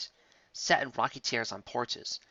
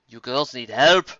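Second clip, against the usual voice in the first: louder, slow call down into the pit; 9 dB louder, slower than usual. A narrator's reading. Medley calling down into the pit, loud and slow. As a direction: call it down from the top. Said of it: "called down Medley".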